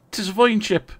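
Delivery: high, loud mock-Irish exclamation; attempted Irish accent. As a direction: put on a playful Irish lilt. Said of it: "Apologies for the attempted Irish accent".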